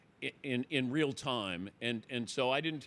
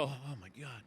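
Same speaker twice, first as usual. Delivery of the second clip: much softer, under the breath; stunned aside; 2 semitones lower, 9 dB quieter than usual. Retold or imitated. Host realizing he must interview Holmes, stunned soft aside. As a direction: let it slip out in shock. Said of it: "And I go".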